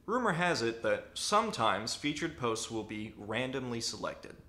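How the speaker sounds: ordinary talk, nothing marked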